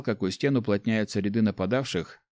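ordinary delivery, nothing marked

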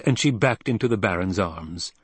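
plain reading voice

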